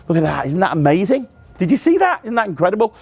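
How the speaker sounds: hammed-up wonder, pitch leaping high on "see"; performer's mock-astonished patter; mock amazement. Shown as a demonstration. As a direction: sell the trick with showman astonishment. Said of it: "you say".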